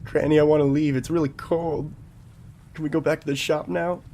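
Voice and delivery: lightly voiced old lady, whiny about the cold; attempted granny voice. Grandkid complaining to granny, lightly voiced granny. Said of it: "I can't do a granny voice".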